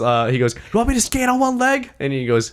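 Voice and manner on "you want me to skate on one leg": high-pitched imitation of a worked-up player. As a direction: act out an indignant question, pitched way up. Retelling player's emotional outburst.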